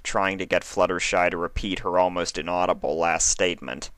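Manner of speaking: plain reading voice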